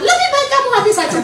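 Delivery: fast high parrot squawk. Acted. Parrot interrupts its owner with a fast squawk. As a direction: blurt it like a bird, quick and high. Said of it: "burung beo itu ngomongnya".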